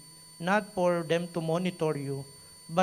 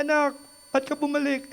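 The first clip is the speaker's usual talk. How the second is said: tearful mother; 9 semitones higher, faster than usual. Retold or imitated. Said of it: "umiyak yung nanay ko".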